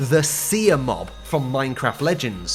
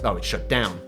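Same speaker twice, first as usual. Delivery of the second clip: deadpan aside; 9 semitones lower, 5 dB quieter than usual. Real, not acted. Deadpan aside after listing.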